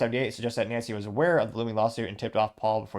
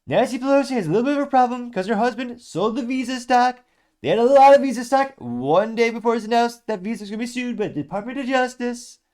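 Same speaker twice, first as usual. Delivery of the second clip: much higher, much louder hammed-up Trump voice; Trump impression; 12 semitones higher, 9 dB louder than usual. Host reading Trump's Pelosi quote aloud, loud high Trump impression. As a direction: read the quote as a blustering Trump. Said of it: "get my Trump ass-, accent going".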